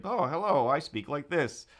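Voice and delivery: mock a contestant's put-on way of talking; put-on interview voice. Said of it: "he's totally just like".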